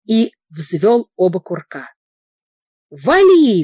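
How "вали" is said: a bright cheerful call, higher and louder; cheerful shout. A narrator's reading. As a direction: shout the go-ahead to the guards with glee. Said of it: "весело крикнул Иннокентий Филатыч стражникам".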